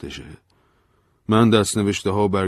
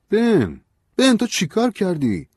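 much higher, louder alarmed plea; alarmed cry; 7 dB louder, slower than usual. Acted. Narrator alarmed, begging Ben to tell what he did, frightened plea. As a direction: cry out his name in alarm.

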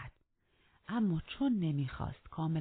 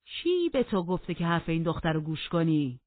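angry demand; 10 dB louder than usual. Acted. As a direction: demand an answer in anger, loudly. Heathcliff's furious quarrel at table.

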